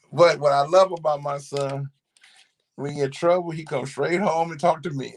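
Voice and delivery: ordinary talk, nothing marked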